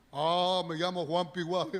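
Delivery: lightly voiced demon giving a name; demon answering. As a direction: play the demon chatting casually. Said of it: "se ponen a conversar con los demonios". (un demonio insinuado, apenas cambiado)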